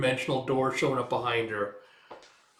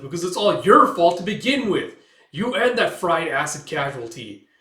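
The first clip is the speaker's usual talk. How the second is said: higher, much louder imitated yell; angry accusation; 5 semitones higher, 10 dB louder than usual. Gail blaming the witch, shouting. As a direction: blame them all, loudly.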